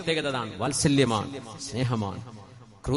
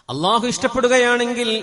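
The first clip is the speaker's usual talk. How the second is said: loud, higher preaching voice; 9 semitones higher, 11 dB louder than usual. Acted. Preacher addressing the congregation, at full force. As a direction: preach it out to the crowd.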